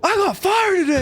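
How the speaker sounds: high, slow, sing-song brag; cheerful announcement. Retold or imitated. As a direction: announce it far too cheerfully. Acted quote to coworker.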